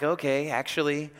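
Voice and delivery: low, mildly exasperated; understated weary patience; eye-rolling resignation. Acted. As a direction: concede it with an eye roll. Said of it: "the eye roll comes because Jesus is like".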